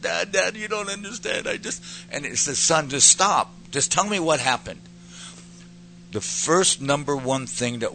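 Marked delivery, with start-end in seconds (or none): 0.0-1.8 put-on high, pleading child's wail; pitched-up tearful protest; imitated crying. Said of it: "I'm crying". 2.6-4.8 louder, higher interjection to a crying son; acted fatherly interruption, firm and emphatic.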